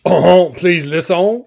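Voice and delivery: slow, hammed-up French accent; mock French with drawn-out vowels. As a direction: plead in a stagey French accent. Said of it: "Or if they're French".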